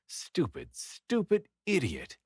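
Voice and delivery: drawn-out, pitch jumping high on the second stupid; exasperated self-reproach, rising high; self-scolding. Acted. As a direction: scold yourself in disgust, slowly. Berating himself aloud.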